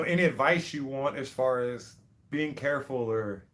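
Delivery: normal talking voice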